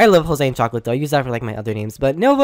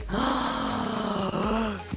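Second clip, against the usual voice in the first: breathless shocked gasp; a sharp intake of breath in shock; dramatic gasp; 5 semitones higher, 12 dB quieter than usual. Retold or imitated. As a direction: gasp in horror. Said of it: "I was like".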